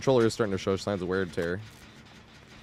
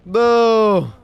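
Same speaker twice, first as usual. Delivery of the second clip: booing; 12 semitones higher, 10 dB louder than usual. Real, not acted. Booing at the game.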